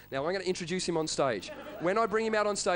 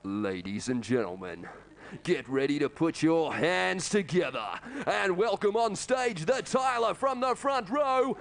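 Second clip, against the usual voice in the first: slow, booming ring announcer build; announcer intro; 3 semitones higher, much slower than usual. Acted. Comic introducing Jerry to the crowd, slow booming announcer. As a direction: drag it out like a boxing announcer.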